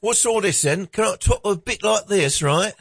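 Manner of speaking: high, hammed-up British voice; British accent. Shown as a demonstration. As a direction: demonstrate a cartoon British accent. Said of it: "say something in a British accent".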